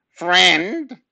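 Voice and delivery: loud, high, scornful snarl; snarled question. A narrator's reading. Neighbour doubts the boy is a friend, loud snarl. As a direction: throw it back with a loud snarl. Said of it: "snarled Mister Parker".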